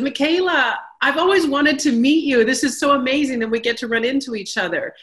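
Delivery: a hint of an excited man greeting a colleague; warm greeting. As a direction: greet her like an old friend you admire. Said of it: "warmly said".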